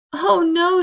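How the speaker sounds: sudden storybook fright; alarmed cry. Acted. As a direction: cry out in a hammed-up panic. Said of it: "she cried".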